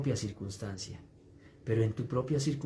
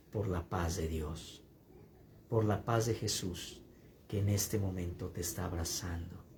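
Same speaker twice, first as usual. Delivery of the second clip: prayerful pastor; 4 semitones lower than usual. Real, not acted. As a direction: let peace settle over them. Prayerful slow passage.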